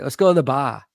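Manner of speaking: Boston accent, BAR without its R. Shown as a demonstration. Host showing Jamie how Boston people talk, Boston accent. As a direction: say BAR as "bah", Boston style. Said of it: "they do say".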